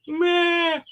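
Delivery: loud, high bleating wail; imitated baby cry. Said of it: "When the kid starts crying".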